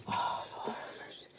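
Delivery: breathless whispered prayer; whispering. Real, not acted. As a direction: barely voice it, in pain. Burning mouth, whispered plea.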